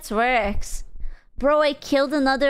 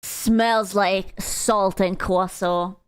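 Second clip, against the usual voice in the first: lower, slow sighing sneer; mock sigh; 5 semitones lower, much slower than usual. Streamer sighs a slow sneer at French commenter. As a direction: sigh it out dismissively. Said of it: "I was like".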